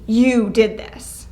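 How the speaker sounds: slow, pointed blame; accusing tone. Shown as a demonstration. Teacher showing the class the accusing tone. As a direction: jab it at them. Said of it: "a very different tone from the".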